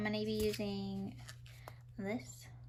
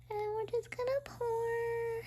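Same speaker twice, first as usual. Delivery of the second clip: high cooing voice; 11 semitones higher, slower than usual. Acted. Cooing while pouring yeast.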